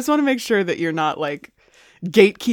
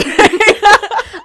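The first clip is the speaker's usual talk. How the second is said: bursting into a loud, high laugh; 12 semitones higher, 11 dB louder than usual. Real, not acted. Laughing at own crush.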